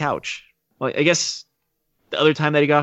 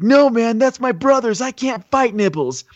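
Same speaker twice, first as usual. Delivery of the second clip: lightly acted higher-pitched protest; mock pleading, voice pitched up; pleading fighter; 10 semitones higher than usual. Killer refusing to fight his brother, mock pleading.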